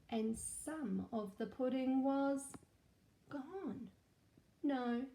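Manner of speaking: lively storybook read-aloud; animated picture-book narration; story reading to children. Acted. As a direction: read it like a storybook for little ones.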